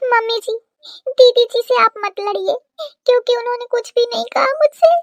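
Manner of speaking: meek, whimpering plea; tearful. Acted. Crying bride defends didi to mother-in-law, tearful. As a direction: plead in a small weepy voice. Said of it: "तो कहे रोवत है तू".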